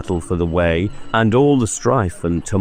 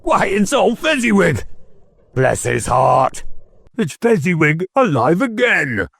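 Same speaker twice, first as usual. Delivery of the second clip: put-on much higher, thrilled old-man cry; great excitement; 12 semitones higher than usual. Scrooge spotting his old master, thrilled and high. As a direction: burst out with delight at a dear memory. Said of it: "cried in a great excitement".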